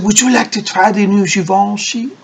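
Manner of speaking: lightly imitated French-accented saleslady; a touch of a French accent, quoting her. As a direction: put on a mild French accent. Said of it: "she spoke in French accent".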